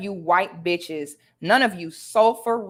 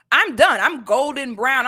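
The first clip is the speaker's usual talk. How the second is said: higher and louder, crowing; proud sassy boast; 4 semitones higher, 6 dB louder than usual. Real, not acted. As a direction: brag it out, triumphant. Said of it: "boasting retort".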